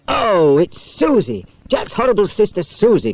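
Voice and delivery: a touch of outrage at the pest; mild indignation. Acted. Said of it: "cried Barbara indignantly".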